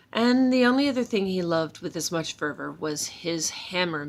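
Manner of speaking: plain reading voice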